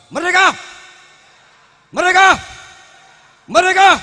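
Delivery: shouted rallying cry, high and loud; rally speech. Real, not acted. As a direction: bellow it to the crowd. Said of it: "mari kita pekikkan".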